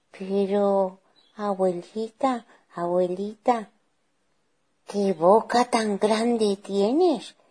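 slow, high wondering voice; drawn-out child's astonishment; puzzled little girl. Acted. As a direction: marvel uneasily, stretching the question. Girl puzzled by granny's mouth.

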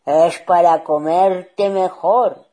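slow, very loud menacing roar; big bad wolf, loud and drawn out; wolf's shout. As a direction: bellow it as you pounce. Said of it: "gritó el lobo".

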